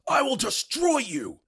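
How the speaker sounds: high, drawn-out imitation of a scream; mock scream. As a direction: scream it like an overblown villain. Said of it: "Then he literally screams".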